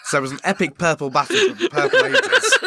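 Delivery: words breaking into laughter; can't hold it together through the line; giggling through a mock epic line; laughing. Real, not acted. Retelling silly story, cracks up.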